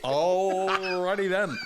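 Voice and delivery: drawn-out, slow "Alrighty then" in a stretched comic voice; Jim Carrey impression. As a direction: stretch it out like Ace Ventura. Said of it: "Jim Carrey in The Passion".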